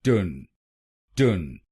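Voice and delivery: टन as "tun" with a schwa; correct syllable. Shown as a demonstration. Teacher drilling the third syllable, correctly sounded. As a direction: say टन with a neutral vowel. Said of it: "तीसरे शब्दांश को बोलिए".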